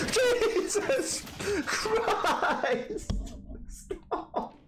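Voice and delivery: high squealing laugh through the words; laugh-scream in fright; startled giggling squeal; laughing shriek. Real, not acted. Jump scare in horror game.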